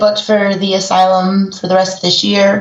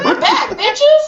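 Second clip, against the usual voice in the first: excited; much slower than usual. Real, not acted. Podcast hosts returning, laughing.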